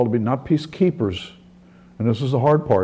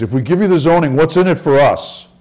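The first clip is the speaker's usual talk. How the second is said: higher and louder, a demanding mock shout; demanding yell; 6 semitones higher, 7 dB louder than usual. Angry woman at the town council yelling her demand. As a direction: throw the challenge at the speaker from the crowd. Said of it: "some lady screams".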